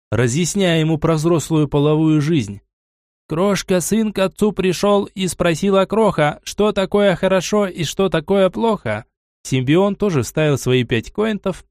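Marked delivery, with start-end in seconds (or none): 3.3-9.1 sing-song school recitation; recited children's poem. Acted.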